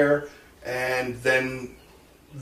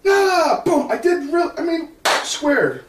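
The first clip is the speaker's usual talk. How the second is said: proud excitement; 4 dB louder, slower than usual. Retold or imitated. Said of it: "I go".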